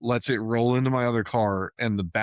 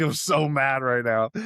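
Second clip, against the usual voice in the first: mock mad; 4 semitones higher, 3 dB louder than usual. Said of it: "I was like".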